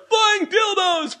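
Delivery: high, slow shouted chant; rallying shout. Retold or imitated. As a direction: lead the crowd, finger in the air. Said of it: "finger in the air like".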